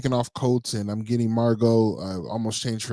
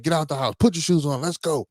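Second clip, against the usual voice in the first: retold yell; 6 semitones higher than usual. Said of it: "I'm yelling".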